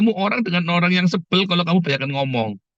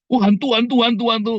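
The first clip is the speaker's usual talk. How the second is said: mock fright; 7 semitones higher than usual. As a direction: play the startled coward seeing ghosts. Acting scared of ghosts. (pura-pura ketakutan melihat hantu, suara lebih tinggi)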